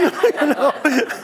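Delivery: high, loud burst of laughter. Real, not acted. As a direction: crack up at the memory. Laughs at own story.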